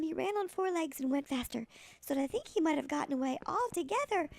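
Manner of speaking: ordinary delivery, nothing marked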